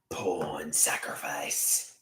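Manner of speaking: low, slow whispered title. Acted.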